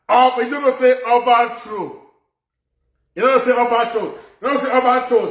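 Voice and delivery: scolding the governor, loud. Real, not acted. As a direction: hammer it at him. Scolding, repeated louder.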